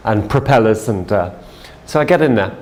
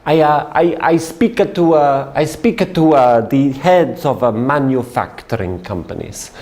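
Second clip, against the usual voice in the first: put-on light Italian accent, searching for words; halting, lightly accented Italian explaining his job; Italian businessman. Salesman explaining his work to his seatmate, light Italian accent. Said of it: "And he says".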